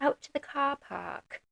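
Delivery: slow, drawn-out British car park; mock British accent drawing out the words. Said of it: "real British accent".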